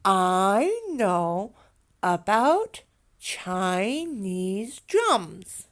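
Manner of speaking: slow, drawn-out teaching delivery; careful classroom modelling of the sentence; slow model sentence. Shown as a demonstration. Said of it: "let's practice our sentence".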